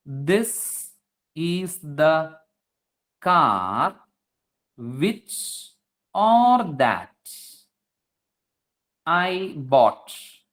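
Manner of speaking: word by word with long pauses; stretched words, widely spaced; slow dictation. Shown as a demonstration. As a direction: spell out the example sentence slowly for a class. Teacher dictates the example sentence.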